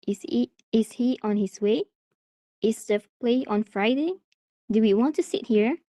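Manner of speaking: questions lifting on "way", "Friday" and "here"; rising intonation. Shown as a demonstration. Teacher demonstrating yes-no questions for learners, rising intonation. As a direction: let the pitch climb at the end, on "way", "Friday", "here". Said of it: "that is how rising at the end works".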